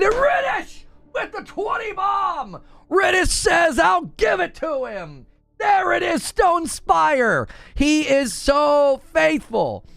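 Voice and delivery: excited gift shout-out; hype shout. Real, not acted. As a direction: cheer the donation like a play-by-play. Hyping gifted subs.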